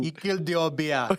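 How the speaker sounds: put-on high Jamaican accent. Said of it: "I started doing a Jamaican accent".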